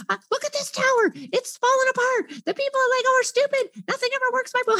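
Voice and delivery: put-on high whiny kid; whining child. Child voiced.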